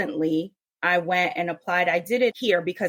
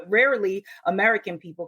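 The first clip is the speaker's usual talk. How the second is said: ordinary talk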